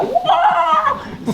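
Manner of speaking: very high squealed cow cry, laughing; playful high mock moo; cow answering. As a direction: imitate the cow calling back, with a laugh. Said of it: "And she'd go".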